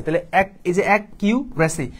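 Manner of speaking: slow, drawn-out অ্যাকিউরেসি, split into its pieces; broken-up reading. Shown as a demonstration. Teacher to students, showing the broken-up spelling reading: slow syllables. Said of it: "ভেঙে ভেঙে পড়বা".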